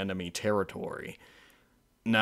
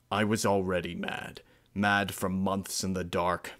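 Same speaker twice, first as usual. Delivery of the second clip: ordinary delivery, nothing marked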